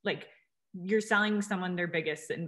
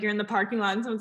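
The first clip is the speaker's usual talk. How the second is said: ordinary delivery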